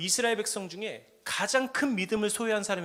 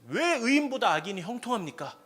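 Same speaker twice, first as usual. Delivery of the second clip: complaining question; 5 semitones higher, 7 dB louder than usual. Acted. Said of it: "우리가 이런 질문 많이 하잖아요".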